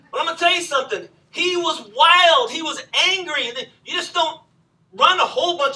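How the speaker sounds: loud, emphatic preacher punching each line; preaching at full force. Real, not acted. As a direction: hammer it home to the congregation.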